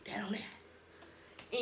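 slow whispered aside. Real, not acted. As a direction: breathe it out under the voice, very slow.

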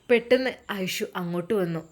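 ordinary delivery

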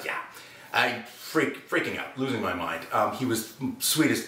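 ordinary talk

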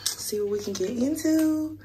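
lost voice, hoarse and soft. Real, not acted. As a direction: speak through a voice that's gone. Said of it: "my voice is gone".

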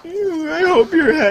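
loud high squeaky shout; high cartoon voice. Character voice.

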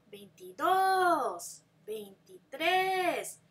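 slow teacher stress on the accented ends; stressed accented ending. Shown as a demonstration. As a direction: drag out the accented last syllable. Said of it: "four numbers in Spanish that have accents".

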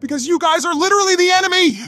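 high, fast indignant retort; exasperated. Acted. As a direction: fire it back fast and high. Answering a despairing rival.

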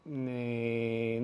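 normal talking voice

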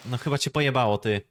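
normal talking voice

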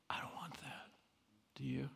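a hushed admission to the class; whisper. Real, not acted. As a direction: drop to a whisper. Confessing softly after outburst.

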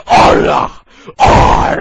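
loud rough roar of rage. Acted. Hero lunges at the one who summoned him, rough roar. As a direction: let out a rasping roar.

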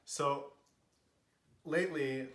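usual speaking voice

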